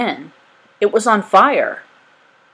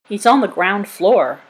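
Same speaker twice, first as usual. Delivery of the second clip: ordinary delivery, nothing marked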